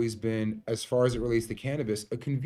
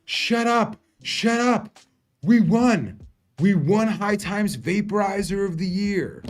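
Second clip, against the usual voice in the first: much higher and louder, bursting with joy; screaming with disbelieving delight; ecstatic yell; 9 semitones higher, 8 dB louder, slower than usual. Retold or imitated. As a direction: shout the good news down the phone. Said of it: "I'm like".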